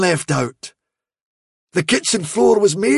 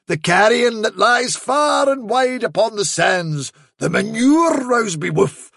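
sweeping mystical proclamation, swooping high; grand fairy dog, mysterious; 4 semitones higher, slower than usual. Acted. Trickster introduces himself as fairy dog to the hound, grandiose. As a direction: conjure far-off wonders with drawn-out vowels.

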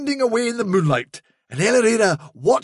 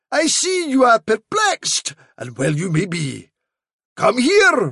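grand fairy messenger, coaxing; 3 semitones higher than usual. Acted. Fairy dog impostor coaxes hound to the fence, grandly. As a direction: draw the listener in with lofty vowels.

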